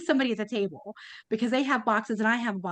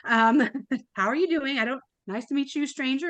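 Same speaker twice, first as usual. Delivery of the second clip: flustered surprise; 4 semitones higher than usual. Retold or imitated. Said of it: "I was looking around like".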